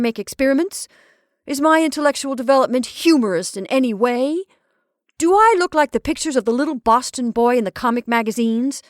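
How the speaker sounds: put-on indignant young scholar. A narrator's reading. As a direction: build an offended lecture up to a cutoff. Horace protests, growing indignant.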